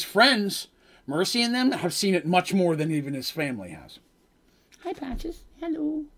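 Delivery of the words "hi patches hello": very high, soft little hello; pet-greeting voice. Real, not acted. Greeting little Patches who came to hear the story, high cooing. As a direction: coo softly to a pet, way up high.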